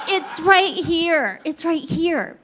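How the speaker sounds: mock-exasperated showing; insistent pointing-out. Owning up, pointing at hidden spot.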